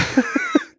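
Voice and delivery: breathy laugh. Real, not acted. Laughs mid sentence.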